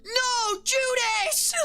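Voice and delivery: a shrieking sob of disbelief; mock crying wail. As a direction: wail it like a heartbroken fan mid-sob. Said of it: "And he's, like, crying".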